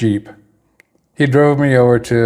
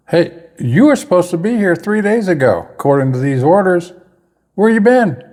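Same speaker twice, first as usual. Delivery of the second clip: mock reprimand, pitched higher; retold confrontation; 8 semitones higher than usual. Desk sergeant confronts late private, retold reprimand. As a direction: challenge him like a desk sergeant.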